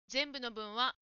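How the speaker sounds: usual speaking voice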